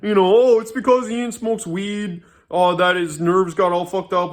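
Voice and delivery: mocking butthurt Pat. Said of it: "Pat proceeds to get butthurt and angry".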